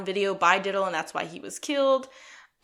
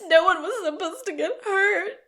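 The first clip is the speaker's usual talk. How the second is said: much higher, put-on female voice; pleading confession; 11 semitones higher, 4 dB louder than usual. Chelsea admits guilt to the police, much higher voice. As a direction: plead it like a caught culprit. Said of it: "She admits".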